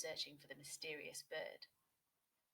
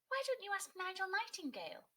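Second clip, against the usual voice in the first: high, lively helpful little bird; bright bird friend voice; 11 semitones higher, 4 dB louder than usual. A narrator's reading. A nightjar helping Otis, high and bright. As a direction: chirp the suggestion up high and louder.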